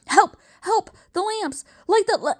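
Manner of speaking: very high desperate shrieks cut off; terrified cries. Acted. Strangled professor screams for light, very high. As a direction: scream for the lamps.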